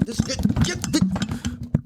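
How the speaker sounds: very high, slow wailing cries; hammed-up shriek as he's dragged off; Jordan Peterson. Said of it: "Jordan Peterson kept trying to fucking steal my show".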